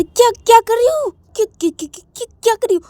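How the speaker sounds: high, loud flustered stammering; startled shock. Acted. Startled after a sudden kiss on the cheek, flustered and high. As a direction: caught off guard, the same word tumbling out. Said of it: "तो तभी श्रेया बोलती".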